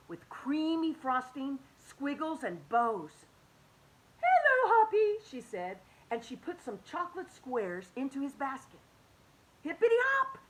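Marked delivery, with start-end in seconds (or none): 4.2-5.2 high, loud sing-song hello; sweet auntie greeting. Acted. Aunt Sassafras calls out to Hoppy, high and bright. 9.6-10.4 high, loud happy squeal; excited exclamation. Acted. Hoppy cheers after getting chocolate, high and loud. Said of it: "Hoppy exclaimed".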